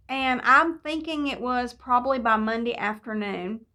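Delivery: ordinary talk, nothing marked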